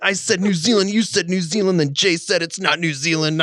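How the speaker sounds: high-pitched exasperated rant to God; so mad. Retold or imitated. Man retelling his argument with the Lord, mad. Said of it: "I was so mad".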